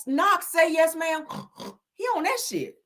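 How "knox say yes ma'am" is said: imitating a mother scolding. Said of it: "She's like".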